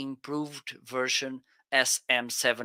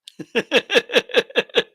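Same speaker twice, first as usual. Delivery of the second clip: high, loud giggling; laugh; 11 semitones higher, 9 dB louder than usual. Real, not acted. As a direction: break into rapid high laughter. Laughs after mock radio announcement.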